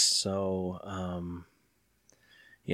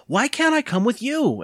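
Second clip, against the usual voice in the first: high-pitched whining demand; 13 semitones higher than usual. A narrator's reading. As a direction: demand it like a child, voice way up. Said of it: "Evan demanded".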